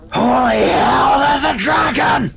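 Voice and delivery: distant panicked shout, high and loud. Acted. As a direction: yell it from outside in alarm. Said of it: "he is shouting".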